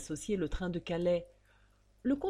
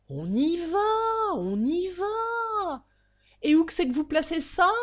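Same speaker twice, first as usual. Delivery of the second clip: high, slow drawling cabman then quicker question; lazy coachman; 10 semitones higher, 6 dB louder than usual. Acted. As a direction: stretch out the reassurance, then ask fast. Coachman answers.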